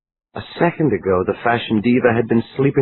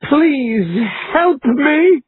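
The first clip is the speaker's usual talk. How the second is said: very high, slow, sobbing plea; high wailing sob of a plea; yelled through a sob; much slower than usual. A narrator's reading. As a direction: drag it out, crying. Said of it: "she yelled after a loud, rattling sob".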